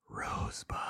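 very soft movie-line whisper. Acted. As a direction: whisper it like a film's first line. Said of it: "my five whispered words".

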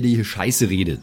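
ordinary talk, nothing marked

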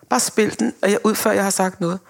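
ordinary talk

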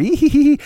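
high, put-on giggle; mock giggle. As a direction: imitate girls giggling. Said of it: "And they would just giggle".

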